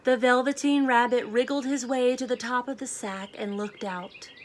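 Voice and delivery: clear, lively storybook read-aloud; children's story narration with gentle emphasis. Acted. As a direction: read it like a picture book to a class. Teacher reads picture book aloud.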